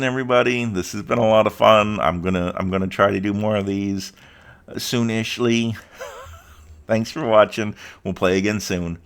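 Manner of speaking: ordinary talk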